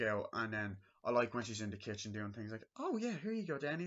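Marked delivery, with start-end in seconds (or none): 2.8-3.8 high, cheery mock mom; lightly sing-song mother at breakfast; imitating Wendy. Wendy handing Danny breakfast in a high cheery voice.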